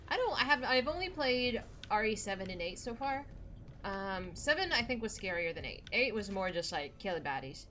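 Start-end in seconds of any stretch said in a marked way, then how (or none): none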